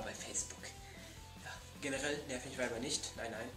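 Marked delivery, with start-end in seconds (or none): none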